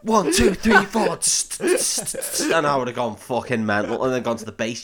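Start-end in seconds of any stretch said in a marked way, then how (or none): 0.0-1.2 high, loud count-in; shouted count-in. Pretend. 1.2-2.6 cymbal imitation.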